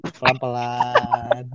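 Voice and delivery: very slowly, each syllable stretched; slow and drawn out. Real, not acted. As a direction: drag out "Pelan-pelan" slowly. Urging friend to calm down.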